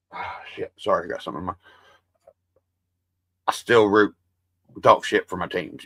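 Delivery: normal talking voice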